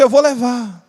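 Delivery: normal talking voice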